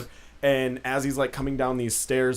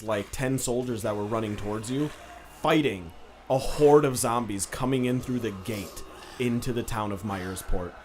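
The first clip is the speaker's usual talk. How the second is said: normal talking voice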